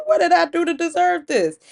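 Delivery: high, loud, incredulous complaint; aggrieved protest. Acted. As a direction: wail it out like someone unfairly punished. Stunned husband protests his punishment.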